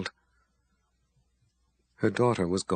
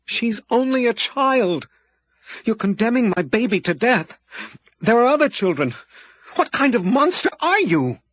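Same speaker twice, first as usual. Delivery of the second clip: anguished crying plea; 11 semitones higher, 5 dB louder than usual. A narrator's reading. Abby accuses the wizard of condemning her child, anguished. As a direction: accuse him through despair.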